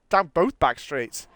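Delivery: ordinary talk, nothing marked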